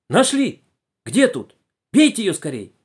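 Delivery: loud, high urgent cries; excited shouting. Acted. Passengers crowd in on the found viper, shouting excitedly. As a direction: urge them on in excitement.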